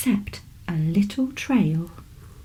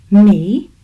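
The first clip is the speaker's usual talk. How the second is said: put-on surprised boy, rising sharply; a puzzled child asking; 6 dB louder than usual. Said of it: "Ollie said, puzzled".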